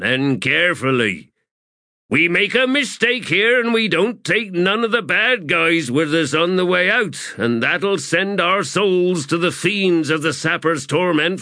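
high, projected crew warning; commanding address. Acted. Corporal warning sappers of Torment, commanding.

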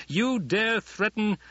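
high, loud, slow indignant outburst; outraged. A narrator's reading. Emperor raging at Paul, cut off mid-threat. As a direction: choke on the insult.

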